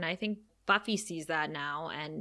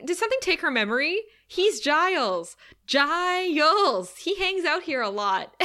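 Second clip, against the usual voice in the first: higher, louder, exasperated Cordelia; imitating Cordelia; 7 semitones higher, 4 dB louder than usual. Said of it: "she says to Giles".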